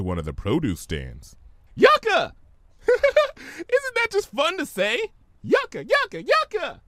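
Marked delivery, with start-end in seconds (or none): 1.7-6.8 high, giddy, laughing repetition; gleeful squeals. Acted. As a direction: squeal the word with delight. Pinkie gleefully repeating a word.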